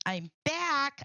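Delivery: lightly sing-song, drawn-out announcement; imitating a returning friend. Host echoing a friend who comes in, playful drawn-out announcement. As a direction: stretch out a playful arrival call. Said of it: "when Judy comes in and says".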